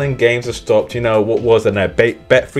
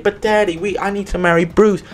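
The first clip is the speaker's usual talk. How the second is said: higher whiny mock plea; pleading daughter; 9 semitones higher than usual. Andrea begging her father to stay, higher mock plea. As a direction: beg like a spoilt daughter, higher. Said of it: "It's like".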